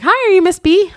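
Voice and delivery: high, loud hello to a stranger; chirpy greeting. Retold or imitated. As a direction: call out a greeting, pitched right up. Responders greet her in lobby.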